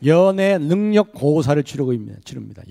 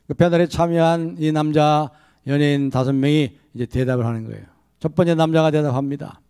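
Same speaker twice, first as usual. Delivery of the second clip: ordinary delivery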